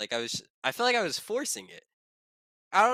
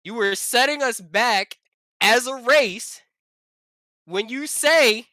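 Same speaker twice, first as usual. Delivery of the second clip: emphatic rant; 7 semitones higher, 9 dB louder than usual. Real, not acted. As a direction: raise voice and pitch, pausing between phrases.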